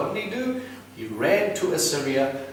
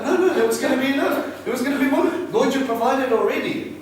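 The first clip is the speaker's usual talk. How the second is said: high, loud mock protest; mock pleading; 13 semitones higher, 5 dB louder than usual. Believer protesting to God about the money, high mock pleading. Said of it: "you were just like".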